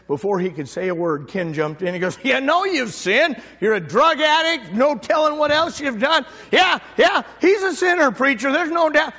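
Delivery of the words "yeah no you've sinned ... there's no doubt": higher, pushy jump-in voice; zealous brother cutting in, excited and pushy; eager interruption. Retold or imitated. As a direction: barge in, too eager to help.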